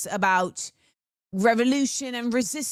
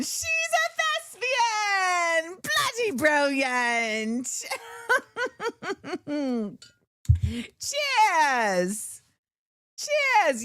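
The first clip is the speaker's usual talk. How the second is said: put-on high, slow, playful British accent with a raised toast; 8 semitones higher, much slower than usual. Host cheering Reese in a mock British accent. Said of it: "we got a British accent that came out".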